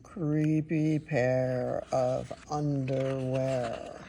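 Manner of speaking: slow spooky read. Acted. Spooky title reread slowly.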